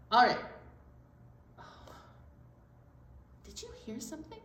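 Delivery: ordinary delivery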